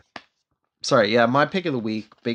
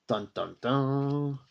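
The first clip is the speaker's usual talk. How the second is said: slow, sung-out dramatic sting; mock soundtrack, each note held out; suspense sting; 7 dB quieter, much slower than usual. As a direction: intone a cliffhanger cue. Spoken suspense sting after cliffhanger.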